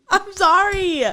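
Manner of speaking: high, giggly apology; laughing apology. Real, not acted. Host apologizing after her own outburst, laughing. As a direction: crack up, then apologize through the laugh.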